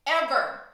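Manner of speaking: EVER hit hard and loud on its own; one loud punched word Ever; emphatic ever. Retold or imitated. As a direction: slam the word Ever as a final warning. Warning him never to try again.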